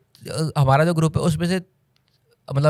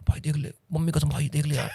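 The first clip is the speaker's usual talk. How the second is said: mock pleading; 7 dB quieter than usual. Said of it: "उस level पे नहीं जाऊँगा".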